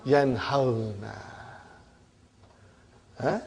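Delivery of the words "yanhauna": Yanhauna drawn out slowly, au gliding into na; teacher modelling Yanhauna slowly; slow demonstration. Shown as a demonstration. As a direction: spell it out by ear, Yanhauna. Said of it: "now can you pronounce".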